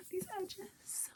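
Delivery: breathless whisper of awe; hushed, awed whisper. Real, not acted. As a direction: barely breathe the words. Admiring book edges.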